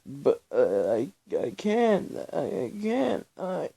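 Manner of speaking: high, stammering voice cracking with sobs; falling apart mid-word, choking on each attempt; shaky broken stammer on the edge of tears; breaking down. A narrator's reading. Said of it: "Belle began to break down".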